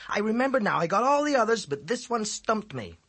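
high, loud schoolboy voice making excuses; boy's excuse. A narrator's reading. Boy excuses himself to the teacher, high and pleading. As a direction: talk your way out of trouble. Ezzy voice.

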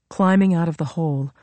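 plain reading voice

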